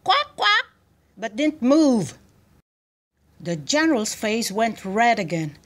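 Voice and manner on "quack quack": very high-pitched quacks; duck quacking. A narrator's reading. Duck answering the general, quacking. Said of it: "The duck said".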